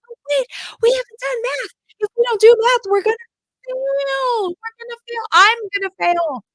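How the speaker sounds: high, loud panicking plea; panicked mom. Retold or imitated. Mom imitating her own high, loud panic that they will fail math. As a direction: spiral into a panic about math. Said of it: "and I'm like".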